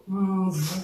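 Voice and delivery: Mvu with a humming, buzzing m before the vu. Shown as a demonstration. Teacher shows the buzz in Mvu. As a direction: hum the m into Mvu like a buzzing insect. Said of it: "d'imiter le bourdonnement".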